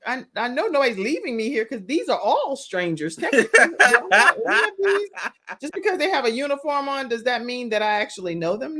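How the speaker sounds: quick, high comic objection; mock indignant daughter. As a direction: imitate a kid arguing back, half laughing. Voices daughter objecting in hospital.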